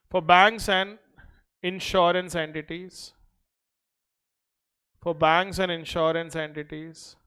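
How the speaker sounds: slow, even dictation pace. Acted. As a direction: read it out for notes. Dictates notes to class.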